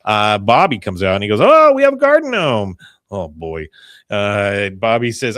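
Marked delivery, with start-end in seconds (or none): 1.4-2.8 high, loud burst of delight; excited boy. Acted. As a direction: exclaim it like a thrilled kid. Bobby voiced excitedly seeing the gnome.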